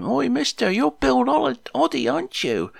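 put-on cheeky kid calling. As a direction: pitch it way up like a cheeky boy spotting a celebrity. Kids calling out to Bill Oddie.

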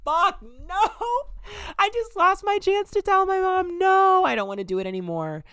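very high, loud mock wail; dismayed outburst. Lost the chance to tell mom, dismayed wail. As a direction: wail it in hammed-up despair.

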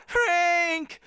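high, strained call; hoarse cry. A narrator's reading. Joe calling for Frank, hoarse high cry. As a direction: cry out the name urgently. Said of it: "he cried hoarsely".

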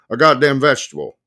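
ordinary delivery, nothing marked

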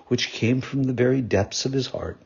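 ordinary delivery, nothing marked

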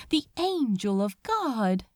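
usual speaking voice

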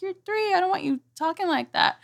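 a hint of a stern mother; lightly voiced mother telling off a toddler; mock scolding. Scolding her daughter, retold.

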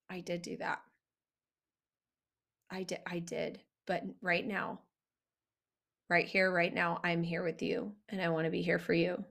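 hushed, low, steady reassurance; soft, tender voice. Shown as a demonstration. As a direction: take the blame gently and calmly reassure. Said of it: "soft, tender voice".

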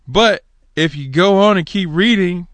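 ordinary talk

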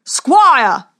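loud bellowed summons; booming warrior king. Acted. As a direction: bellow it over the silent arena. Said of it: "bellowed the king".

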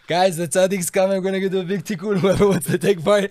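rally everyone to follow the tzaddik; calling out to the crowd. Retold or imitated.

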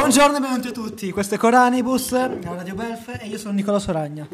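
upbeat radio host announcing the show; radio host intro. Acted.

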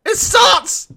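very high, loud frightened yelling; panicked shout. Real, not acted. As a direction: scream it out in panic. Panic as the lights fail.

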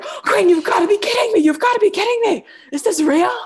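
very high, loud, freaking-out friend; imitated shrieking disbelief. Storyteller voicing her friend's reply, high loud disbelief. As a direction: squeal it in hammed-up disbelief.

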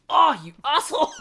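high, loud outburst breaking into laughter; mock outraged yell. Viewer yelling at the screen at Ernesto, mock outrage. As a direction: yell at the villain in playful outrage. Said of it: "you're just yelling".

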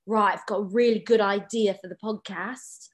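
imitated British accent of an eager dad; dad's British accent. Daughter imitating her dad's calls to Europe, British accent. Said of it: "that's a pretty good accent there".

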